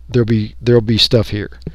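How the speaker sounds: normal talking voice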